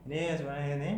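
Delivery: "ini sebenarnya ini" run together with slurred sounds; careless, smeared "ini sebenarnya ini"; dragged, slurred words. Shown as a demonstration. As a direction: slur "ini sebenarnya ini" so the words drag into each other. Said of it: "orang itu nyeret".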